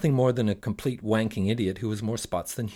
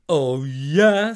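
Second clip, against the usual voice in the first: genie retort; 4 semitones higher, 7 dB louder than usual. Acted.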